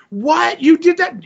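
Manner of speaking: high mock-astonished mom; shocked mother. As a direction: react in disbelief to a son's confession. Mother voiced, shocked by confession.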